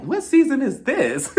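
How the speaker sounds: high, slow surprised question; mock surprise. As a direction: sound baffled. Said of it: "I'm like".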